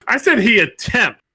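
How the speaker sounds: "attempts" pushed high and held, the rest of the line light; stress on attempts. Real, not acted. Panelist defending his answer, punching "attempts". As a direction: lean hard on "attempts" so nobody misses it. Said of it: "emphasis on the word attempts".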